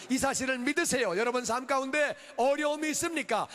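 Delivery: high-energy sermon delivery; impassioned preaching. Real, not acted. Pastor urging congregation to believe God protects, impassioned preaching. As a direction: preach it out with ringing conviction.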